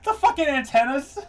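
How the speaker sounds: excited laughing outburst. Real, not acted. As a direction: shout it through laughter. Laughing at character design.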